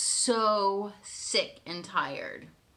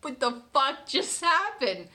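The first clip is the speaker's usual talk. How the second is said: higher, stunned question; shocked disbelief; 6 semitones higher, 3 dB louder than usual. Retold or imitated. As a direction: blurt out the shock. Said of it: "and I'm like".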